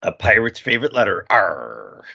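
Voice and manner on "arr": low gruff pirate arr; pirate growl. Pretend. Punchline of pirate joke.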